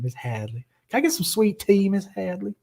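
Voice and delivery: put-on high, soft southern accent. Teasing a friend about the name Miss Hadley, southern accent. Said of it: "say it with a southern accent".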